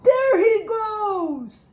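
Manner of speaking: high, loud, slow shout; alarmed shout; vice principal shouting. A narrator's reading. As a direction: call out to the whole school. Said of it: "shouted Vice Principal Tanner".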